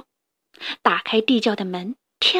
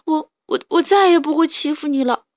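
pitiful stammer; 4 semitones higher, 3 dB louder than usual. Acted. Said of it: "扑哧扑哧地说".

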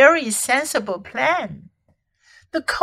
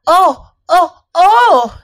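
startled, high and loud "oh"s; acted jolt of surprise, pitched up; sudden exclamation; 6 semitones higher, 8 dB louder, slower than usual. A narrator's reading. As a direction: cry out in sudden alarm. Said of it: "said Susan suddenly".

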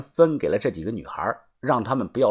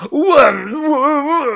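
much higher, crying stammer; terrified stammer; 14 semitones higher, 5 dB louder, much slower than usual. Acted. Captive stammering in terror before the blade, high and crying. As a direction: choke on the words in terror.

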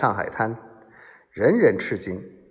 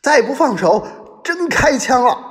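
very high, loud panicked threat; high-pitched agitated yell; frantic shouting; 13 semitones higher, 5 dB louder than usual. A narrator's reading. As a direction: yell a last desperate warning. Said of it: "两名保镖大跳大叫".

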